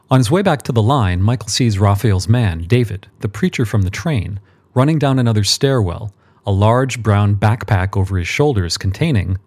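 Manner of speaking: plain reading voice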